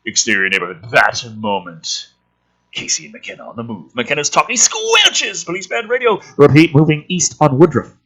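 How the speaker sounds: reading a screenplay aloud, a touch dramatic; lightly dramatic screenplay read; script reading. Acted. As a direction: read the scene headings and action like a narrator.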